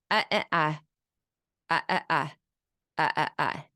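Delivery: "ah, ah, ah" in short, even vowels; lack of intonation. Shown as a demonstration. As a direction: say "ah, ah, ah" with no consonants and no rise. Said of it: "There's a lack of intonation".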